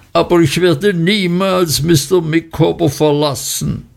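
lightly voiced wife rising to a screech; understated shrill outcry; shrieking vow. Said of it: "zu lautem Kreischen gesteigert".

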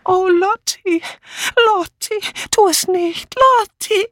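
put-on high, pleading, pitiful old-lady voice; plaintive Dora Banner. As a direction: imitate a frail friend begging her to stop. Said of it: "kläglich Dora Banners Stimme".

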